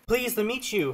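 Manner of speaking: put-on high-pitched shy girl's greeting; sweet and shy. A narrator's reading. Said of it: "responded sweetly with a shy grin".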